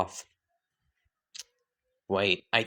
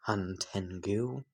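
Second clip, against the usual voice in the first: drawn out slowly and much softer, rising at the end; slow soft drawl; 3 semitones lower, 11 dB quieter, slower than usual. Acted. Doma greeting the newcomer, slow and soft. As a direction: linger on the name, teasing.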